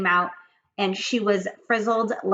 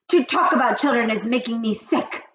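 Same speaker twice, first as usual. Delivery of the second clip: loud witch cry; witch scream; 5 semitones higher, 7 dB louder than usual. Acted. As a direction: shriek in disgust. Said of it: "screamed the Grand High Witch".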